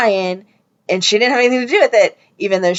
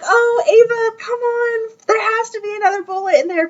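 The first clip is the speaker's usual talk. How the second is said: exasperated plea; 12 semitones higher than usual. Retold or imitated. Retold exasperated plea to show character.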